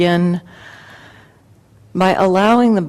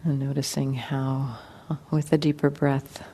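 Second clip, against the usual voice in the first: guided meditation; 3 semitones lower, 8 dB quieter than usual. Acted. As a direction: ease them gently into relaxation, unhurried and quiet.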